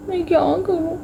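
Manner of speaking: high, whimpering pretend sob; hammed-up fake tears, high and whiny; mock crying. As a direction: whine like a child who can't cry, half joking. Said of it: "मुझे रोना आ रहा है".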